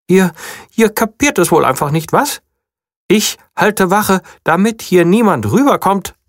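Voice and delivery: high small bunny; little bunny voice. A narrator's reading. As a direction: speak like a proud little guard bunny. Bunny exasperated.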